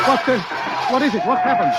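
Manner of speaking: sudden high alarmed questions; jolted by the sudden stop; startled. Acted. Passenger startled as the road stops.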